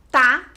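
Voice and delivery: tá set apart, loud and distinct; isolated syllable. Shown as a demonstration. Teacher showing the last syllable, tá loud and alone. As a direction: say tá by itself, punched out. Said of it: "e a última sílaba".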